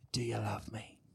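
whispered "Do you love me?", low and breathy, no voice. Shown as a demonstration. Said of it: "whispered take, then voiced take".

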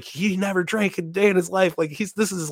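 high-pitched mom impression; protesting mom. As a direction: imitate a mother explaining in disbelief.

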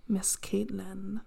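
slow unvoiced whisper; a hushed, drawn-out name. Real, not acted. Naming the sister.

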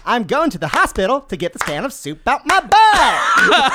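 loud, high, drawn-out singer impression. Player improvising an answer in the game, hollered impression. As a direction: holler it slowly in rhythm. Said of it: "an impersonation of [name]".

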